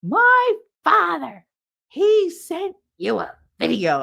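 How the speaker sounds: high, slow, emphatic mock outrage; loud retold meltdown; hysterical. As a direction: wail each word slowly, imitating a hysterical caller. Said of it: "like, hysterical phone call".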